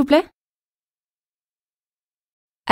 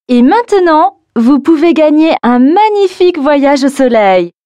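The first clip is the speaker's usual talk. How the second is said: louder, energetic game pitch; radio contest ad; 2 semitones higher, 4 dB louder, faster than usual. Acted. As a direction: hype the prize like a radio ad. (pub de jeu radio)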